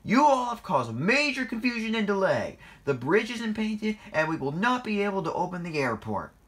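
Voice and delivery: high, loud reprimand, easing slightly; cross scolding. A narrator's reading. Boss blaming the engines for confusion and delay, crossly. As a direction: berate them loudly.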